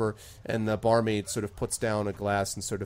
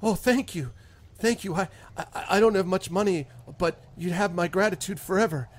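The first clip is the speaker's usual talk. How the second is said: higher, eager, grateful farmer; hopeful farmer; 6 semitones higher than usual. Acted. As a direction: rush the thanks in new hope. Farmer gains hope.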